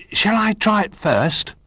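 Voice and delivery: high, slow, eager question; eager little bear. A narrator's reading. Little Bear asks friends for first go, high and eager. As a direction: ask sweetly in a high little bear's voice.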